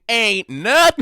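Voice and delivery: loud, high character voice; hammed-up boast. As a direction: belt it out as a mock brag.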